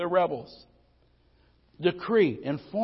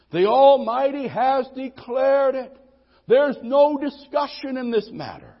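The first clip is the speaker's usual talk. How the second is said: louder, higher, slowing on salvation; rising full-force preaching; 10 semitones higher, 9 dB louder, slower than usual. Acted. Preacher telling the church the matter is settled, loud and insistent. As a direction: drive each "no" home like a verdict.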